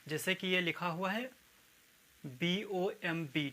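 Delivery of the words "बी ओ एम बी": ordinary talk, nothing marked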